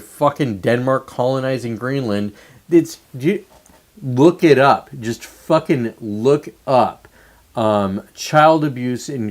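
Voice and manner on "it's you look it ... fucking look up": normal talking voice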